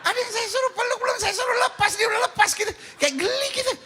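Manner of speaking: high-pitched comic exasperation; mock scolding. Real, not acted. Preacher teasing a husband who let go of his wife, high mock scolding. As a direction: tease him in a high, incredulous squeal.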